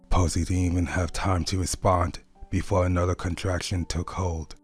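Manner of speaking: ordinary delivery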